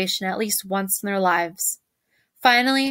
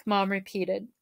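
plain reading voice

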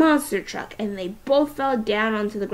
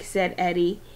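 usual speaking voice

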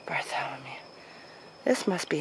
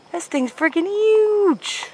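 high, loud, amazed; 8 semitones higher, 9 dB louder than usual. Real, not acted. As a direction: wow at the huge tree. Amazed at huge tree.

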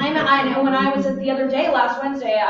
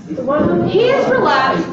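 slow, louder confirmation; emphatic agreement; 6 semitones higher, 5 dB louder, much slower than usual. Real, not acted. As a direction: lean into the agreement. Confirming student answer emphatically.

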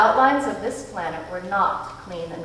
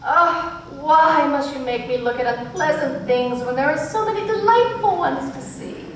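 plaintive medium voice; 7 semitones higher, 4 dB louder than usual. A narrator's reading.

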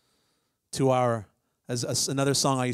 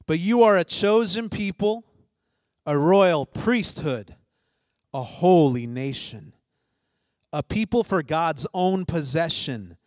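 slow, loud proclaiming of scripture; 2 semitones higher, 3 dB louder than usual. Acted. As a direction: proclaim it boldly, slowly. Reads First Peter aloud.

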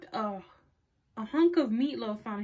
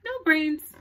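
firm; 5 semitones higher, 8 dB louder than usual. Acted. Reginald answers firmly.

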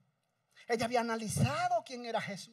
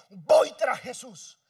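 determined cry; 5 semitones higher, 9 dB louder than usual. Acted. Desperate woman resolving to follow Jesus, building shout. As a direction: cry out her resolve, louder each line. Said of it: "y dijo".

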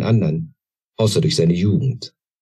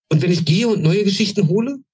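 much higher, a glimmer of hope in it; hopeful; 14 semitones higher than usual. A narrator's reading. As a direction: offer it eagerly, hoping. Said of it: "mit einem Hoffnungsschimmer in der Stimme". (hoch, mit einem Hoffnungsschimmer)

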